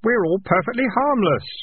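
put-on very high innocent child's voice; kid. Kid reassures the highwayman.

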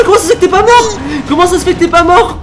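ordinary delivery

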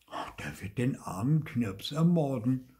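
usual speaking voice

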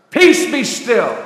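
loud, slow, measured command; firm rebuke. Retold or imitated. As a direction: rebuke the storm with weight on each word. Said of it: "he rebuked the sea and the winds".

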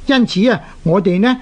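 normal talking voice

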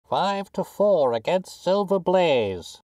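high, drawn-out betting call; slow sing-out roar; roaring bookmaker. A narrator's reading. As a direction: call the odds out over a racecourse crowd. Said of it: "roared the ring".

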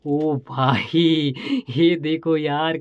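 long stretched oh, in real wonder; stretched-out gasp of amazement; amazed drawn-out exclamation. Real, not acted. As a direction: marvel at it slowly. Amazed at new gadget.

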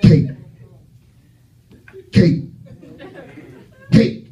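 single beats with long pauses between; slow motion. Retold or imitated. Comic mimicking a slowed-down TV walk, long-gapped slow motion. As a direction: space each word far apart, imitating slowed footage. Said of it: "I can make it slow".

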